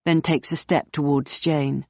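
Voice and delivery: ordinary delivery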